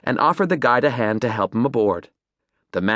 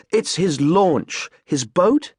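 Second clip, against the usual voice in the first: slow clarifying; 5 semitones higher, much slower than usual. Acted. Adam explaining the word.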